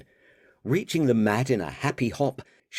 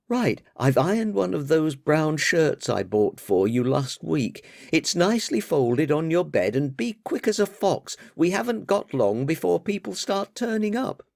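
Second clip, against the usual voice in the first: higher, exasperated daughter voice; 8 semitones higher than usual. Acted. Daughter chiding her father about the party, higher voice. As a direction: scold Dad for never listening, higher.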